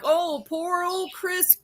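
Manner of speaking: high, fast mocking voice; mocking defenders. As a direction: sneer it out like the apologists. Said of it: "Everybody's acting like".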